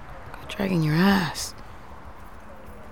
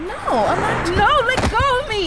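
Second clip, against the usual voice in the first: high, frightened cries; scared driver pulling away from the officer; panicked protest; 12 semitones higher, slower than usual. Acted. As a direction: fight him off in panic. Driver dragged from truck.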